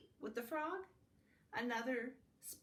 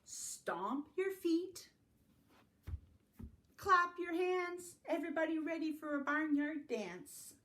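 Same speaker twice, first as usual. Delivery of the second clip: rousing call; 6 semitones higher, 4 dB louder than usual. Acted. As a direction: get everyone up and moving. Rousing the kids to dance.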